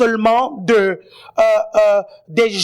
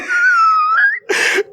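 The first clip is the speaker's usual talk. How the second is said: sudden high laugh; high burst of laughter. Real, not acted. Laughing at own tease.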